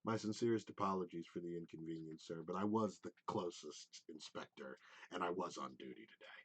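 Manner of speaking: low, soft, smooth apology; charming inspector. Acted. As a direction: win him over with polite calm. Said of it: "he goes".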